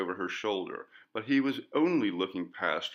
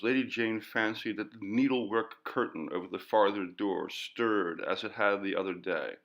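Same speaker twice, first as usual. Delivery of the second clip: ordinary delivery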